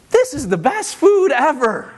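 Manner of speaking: put-on high, slow, loud gushing child voice; a child squealing over the best food, drawn out; delighted kid. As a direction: gush like a kid who suddenly loves it. Child finally tastes food.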